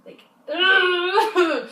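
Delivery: loud, high exasperated laugh into a groan; frustrated laughing groan. Real, not acted. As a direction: let it out, half laugh, half groan. Venting frustration at story end.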